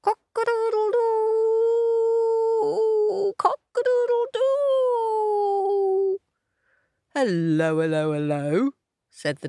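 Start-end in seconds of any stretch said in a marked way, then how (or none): 0.0-6.2 very high, long-held crow; piercingly high cock-a-doodle-doo; cockerel crow. Acted. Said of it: "crowing up a storm". 7.1-8.7 nosy donkey, pitched slightly down; donkey character. Acted. Voices donkey greeting the cockerel.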